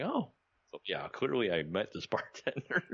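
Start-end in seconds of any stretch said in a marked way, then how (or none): 0.0-0.3 high startled oh; caught-out squeak; surprised oh. Retold or imitated. Embarrassed realization.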